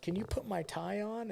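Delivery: soft, high, small-voiced request; mock timid pupil. Pupil asking teacher about his tie, mock meek. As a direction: play a meek kid begging a favor.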